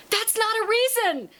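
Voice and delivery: very high, loud mock outcry; exasperated protest. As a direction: imitate indignant friends yelling. Friends protest her answer.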